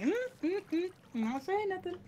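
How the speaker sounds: soft, high humming denial; tight-lipped innocence. Retold or imitated. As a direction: keep out of it under your breath. Keeping quiet while looking down.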